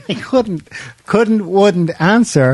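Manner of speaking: laughing through the words, high and slow; chuckling while telling it. Real, not acted. Laughing while recounting the story.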